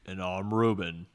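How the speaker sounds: slow, loud mock-deep intro; hammed-up morning-radio voice; playful low voice. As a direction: drag it out like a deep-voiced host. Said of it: "we can have a low, low voice".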